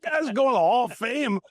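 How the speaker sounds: high, laughing half-awed aside; awed mock aside. Marveling at Jerome Bettis to himself, high laughing aside.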